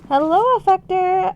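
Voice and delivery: high, loud singsong hello; excited greeting. Real, not acted. Streamer hails a viewer who just arrived, excited greeting. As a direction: call it out high and bright-eyed to a newcomer.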